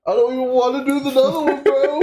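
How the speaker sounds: high, fast whine from the heat; whiny near-crying. Real, not acted. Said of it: "Are you already crying?".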